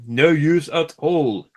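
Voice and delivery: loud, slow sulky moan; gloomy engine. A narrator's reading. Gordon stuck on the hill refuses help, slow loud sulk. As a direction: drag the words out like a sulking engine.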